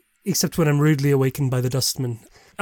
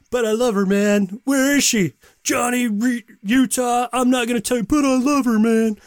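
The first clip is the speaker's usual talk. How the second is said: Point Break surfer; 8 semitones higher than usual. Retold or imitated. Host quotes Point Break scene, higher pleading surfer voice. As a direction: beg like a lovesick surfer, higher. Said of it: "Point Break aside".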